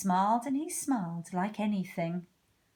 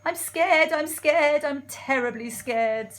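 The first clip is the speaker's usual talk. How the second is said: high, loud frightened refrain; hammed-up scared cook; acted fear; 6 semitones higher, 7 dB louder than usual. Cook declares his fear.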